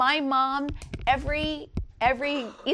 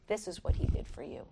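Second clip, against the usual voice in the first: tender mother; 4 semitones lower, 6 dB quieter than usual. Retold or imitated. Mom speaking to her child.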